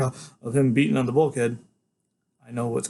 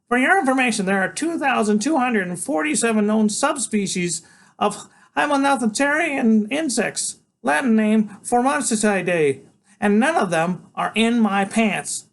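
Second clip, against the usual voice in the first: put-on know-it-all boy; 10 semitones higher, 4 dB louder than usual. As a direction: lecture like a tiny professor. Freak lecturing.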